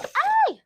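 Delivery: sudden high yelp of surprise; startled yelp. Real, not acted. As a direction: jump and squeal. Startled while unboxing.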